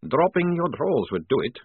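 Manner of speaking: ordinary delivery, nothing marked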